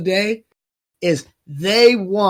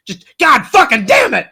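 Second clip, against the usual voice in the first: louder, higher furious curse; real angry outburst; 6 semitones higher, 7 dB louder than usual. Real, not acted. Host losing it over political enemies, angry shout. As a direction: blow up at the demons. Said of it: "I'm upset".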